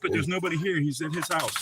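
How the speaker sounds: normal talking voice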